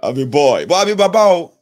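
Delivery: loud, rising insistence that he is a man; emphatic declaration. Real, not acted. Telling older viewers he's young but a grown man, loud and insistent.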